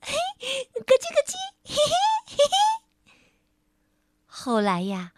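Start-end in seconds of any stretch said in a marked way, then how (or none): 0.0-2.5 put-on very high, giggly girl voice; little girl tickling the crocodile's belly. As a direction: tease and giggle while tickling. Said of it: "还一边说".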